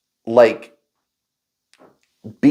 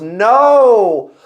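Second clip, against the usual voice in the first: higher, half-laughing plea for help; mock despair; 10 semitones higher, 4 dB louder, slower than usual. What he wished he'd told coworkers, mock pleading. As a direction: play up the desperation lightly. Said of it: "what I really wanted to tell them was".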